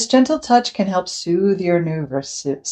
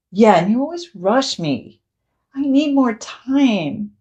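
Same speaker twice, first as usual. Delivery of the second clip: put-on upset child; 6 semitones higher than usual. Parent voices the child.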